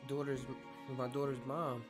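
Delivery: normal talking voice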